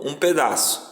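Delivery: ordinary talk, nothing marked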